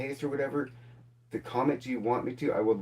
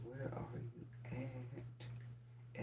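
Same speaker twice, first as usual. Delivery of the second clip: drawn-out whisper; 2 semitones higher, 15 dB quieter, much slower than usual. Real, not acted. Host whispers slowly to absent commenter. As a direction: whisper it, stretched very slow.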